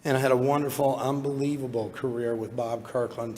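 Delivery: ordinary talk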